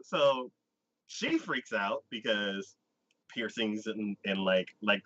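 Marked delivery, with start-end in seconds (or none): none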